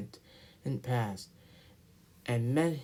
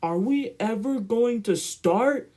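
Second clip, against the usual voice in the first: higher, louder impatient complaint; cross little engine; 8 semitones higher, 6 dB louder than usual. Acted. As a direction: grumble like an engine tired of waiting. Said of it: "Edward began to get cross".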